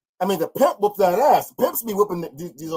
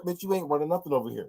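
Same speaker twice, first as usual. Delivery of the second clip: quiet pimp quote; 3 semitones lower, 7 dB quieter, faster than usual. Pretend. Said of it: "He's showing her".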